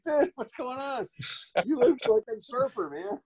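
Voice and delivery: high-pitched re-enacted buddy greeting; excited ribbing. Actor greets friend who jumped on him, high excited ribbing. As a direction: tease an old pal, voice way up. Retold excited reunion banter.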